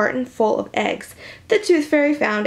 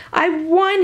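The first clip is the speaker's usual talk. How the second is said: triumphant yell; 7 semitones higher than usual. A narrator's reading. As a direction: shout it like you just won. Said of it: "he yelled".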